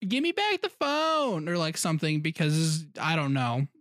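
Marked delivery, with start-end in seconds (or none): 0.1-1.5 high, slow mom voice. Retold or imitated. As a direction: play the mom grabbing the phone. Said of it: "we'll get random, like".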